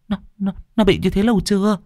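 high, fast, stammering fear; trembling question. Acted. Neighbour Dung asking Hằng nervously, stammering fear. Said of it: "thì run rẩy".

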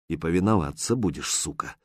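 inner thought. A narrator's reading. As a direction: think it under your breath. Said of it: "подумал Лис".

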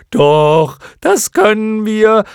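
high, loud creature retort; defiant clown moth. A narrator's reading. Another clown moth answers while wrecking the tower, defiant. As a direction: shout back in a drawn-out silly voice. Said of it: "antwortete eine andere".

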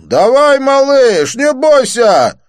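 high, loud jeering shout, slowing at the end; mocking shout. A narrator's reading. The thug jeers at Didier, mocking shout. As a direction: jeer him into the fight. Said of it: "издевательски крикнул".

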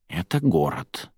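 put-on slow hushed old woman; old woman whispering. A narrator's reading. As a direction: breathe it like a secret. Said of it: "прошептала старушка". (тихий старушечий шёпот)

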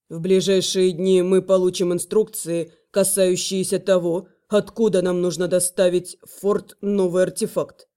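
low, measured male voice; low male dragon voice. Acted. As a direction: speak as a stern dragon guard. Velor gives news.

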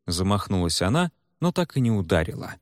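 usual speaking voice

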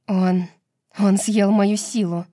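usual speaking voice

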